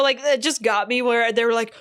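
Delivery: ordinary talk, nothing marked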